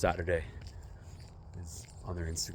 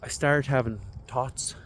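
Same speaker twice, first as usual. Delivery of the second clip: slow, higher, put-on Irish lilt; terrible Irish accent; 6 semitones higher, 5 dB louder, slower than usual. Shown as a demonstration. Vlogger imitating an Irish podcaster he listens to, terrible Irish accent. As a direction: attempt a bad Irish accent, drawn out. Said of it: "I know that's a terrible accent".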